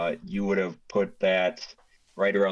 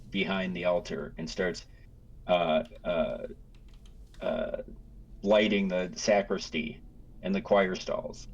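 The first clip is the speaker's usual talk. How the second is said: usual speaking voice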